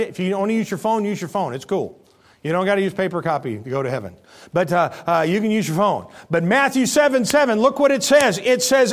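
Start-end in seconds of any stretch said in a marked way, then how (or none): none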